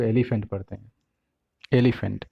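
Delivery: elephant with a full "fant" ending rhyming with pant; elephant, last vowel fully sounded; common mispronunciation. Retold or imitated. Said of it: "इसको पढ़ते हैं लोग".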